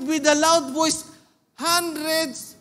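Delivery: ordinary talk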